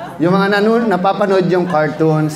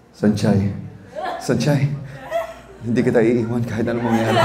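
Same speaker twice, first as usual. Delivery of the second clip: audition line; 5 semitones lower, 5 dB quieter than usual. Retold or imitated. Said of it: "Nung nag-audition ako, sabi ko".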